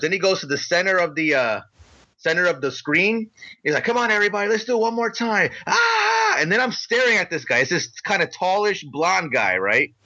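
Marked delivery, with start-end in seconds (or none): 3.8-5.5 higher-pitched call to the room; rally everybody for another round, pitched up; a touch of hype-man urging; lightly voiced crowd rallier. Imitating man rallying crowd once more. 5.7-6.4 very high, sustained mock scream; high-pitched imitation of a yelling crowd; imitated war-face yell. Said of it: "they all start yelling".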